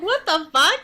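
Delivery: hammed-up shock; mock bafflement. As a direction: act stunned at the glitter. Charlie puzzled, voiced.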